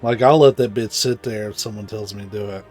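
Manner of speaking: usual speaking voice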